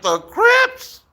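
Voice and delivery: high disbelieving squeak; surprised. Retold or imitated. Boy hearing the gang's name, high surprise. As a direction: shoot way up in surprise. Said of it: "I'm like".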